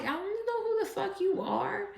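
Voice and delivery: high mock don't know you; dismissive brush-off. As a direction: wave off someone who expects to be known. Brushing off a jealous stranger.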